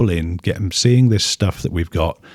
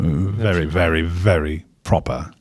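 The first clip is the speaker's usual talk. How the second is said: mock upper-class RP accent; posh RP accent; 2 semitones lower than usual. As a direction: put on a proper RP accent, lightly. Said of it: "work on my, uh, my R P".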